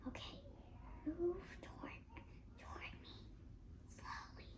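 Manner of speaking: soft unvoiced whisper. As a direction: whisper so the dinosaur won't hear. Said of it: "he said".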